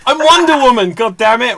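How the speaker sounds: high, loud frustrated outburst; mock exasperation. Host annoyed nobody gets her Wonder Woman, mock exasperated shout. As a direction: throw up your hands at the guessers. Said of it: "my Wonder Woman impression wasn't great".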